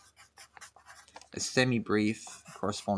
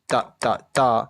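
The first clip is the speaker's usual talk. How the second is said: louder detached syllables; staccato; 7 dB louder than usual. Shown as a demonstration. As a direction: punch each "da" apart. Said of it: "that's called playing staccato".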